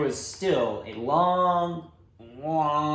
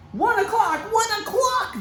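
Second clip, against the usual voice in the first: much higher, louder exclamation; amazed realization; 5 dB louder than usual. Acted. Father realizing the hour matched Jesus' words, amazed. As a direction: it all clicks at once.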